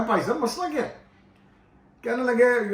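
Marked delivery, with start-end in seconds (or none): 0.1-1.0 pressing the question again, higher; insistent asking. Retold or imitated. Pressing the pastor again, insistent higher repeat. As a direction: push him for an answer this time. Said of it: "میں پھر پیچھے پڑ کے".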